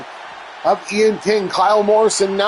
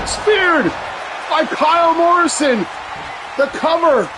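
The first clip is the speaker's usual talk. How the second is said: excited shouting commentator; 9 semitones higher than usual. Acted. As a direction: yell it like the crowd's on its feet. Elimination called.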